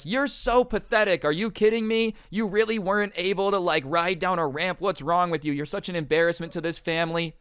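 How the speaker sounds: high-pitched berating, imitating a furious father; screaming dad. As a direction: scold your kid in mock outrage, easing slightly. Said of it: "screaming at your kid, calling him pathetic".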